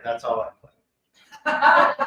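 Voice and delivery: slow, laughing delivery of her answer; laughing reply. Retold or imitated. Voicing the soccer mom client's reply, amused and laughing. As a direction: let it come out slow through a laugh.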